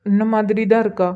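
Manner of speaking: subdued, level-pitched confirmation without a smile; unsmiling. Acted. Husband confirming the likeness to his wife, unsmiling. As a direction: repeat it soberly, with no lift. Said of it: "அத கொஞ்சம் சிரிச்சுக்கிட்டே சொல்லலாம்ல".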